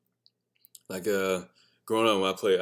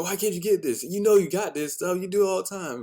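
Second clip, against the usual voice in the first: high, exasperated pleading; frustrated parent; 10 semitones higher, 3 dB louder than usual. Retold or imitated. Helping the kids with homework, high exasperation.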